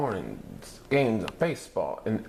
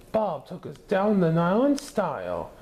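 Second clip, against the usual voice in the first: higher, lightly voiced doctor; reasoning doctor; 9 semitones higher, 3 dB louder than usual. A narrator's reading. Doctor justifying the luxury liner, lightly voiced. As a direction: make the case brightly. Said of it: "reasoned the doctor".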